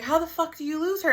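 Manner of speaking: high, exasperated demand; imitating her own angry outburst, pitched high; retold anger. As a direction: re-enact being furious at her dad. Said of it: "I was pissed".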